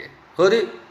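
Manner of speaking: normal talking voice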